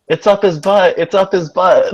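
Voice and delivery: high, singsong teasing; teasing taunt. Acted. Lucy needling Arthur on purpose, teasing.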